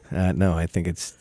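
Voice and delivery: normal talking voice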